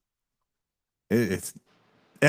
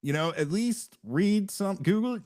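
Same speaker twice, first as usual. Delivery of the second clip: mock lecturing; 7 semitones higher than usual. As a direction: spell it out to him, climbing higher. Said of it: "I said".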